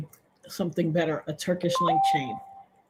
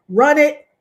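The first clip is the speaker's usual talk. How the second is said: louder, higher bark of run it; robber's command; 7 semitones higher, 7 dB louder than usual. Retold or imitated. Robber demanding the chain, louder barked order. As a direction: snap it like a mugger. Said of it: "he was like this".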